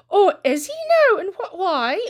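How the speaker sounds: high, loud excited gossip; nosy gossip voice. Retold or imitated. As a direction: pounce on the news. Missus Mackenzie eager.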